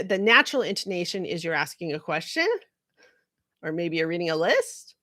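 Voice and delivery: "question" and "list" rising at the end; questioning lift on "question" and "list"; upspeak. Shown as a demonstration. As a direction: swing up on "question" and on "list", as if asking. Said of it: "go up at the end of a sentence".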